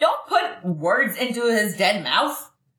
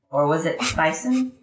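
ordinary talk, nothing marked

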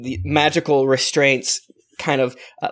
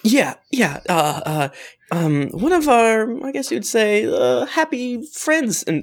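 higher, anxious voice of a sister asking about a friend; worried sister; 5 semitones higher than usual. Acted.

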